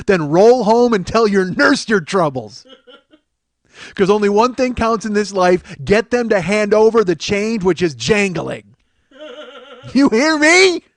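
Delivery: loud, hammered-out sales tirade; hard-sell sales boss. Acted. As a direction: belligerent motivational speech, each slogan punched out.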